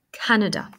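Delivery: "Canada" stressed on CAN, middle A reduced to "uh"; second A as schwa. Shown as a demonstration. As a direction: stress the first syllable of "Canada", then let it fall. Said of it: "c'est comme un son E". (« Canada » : première syllabe forte, deuxième A dit « e »)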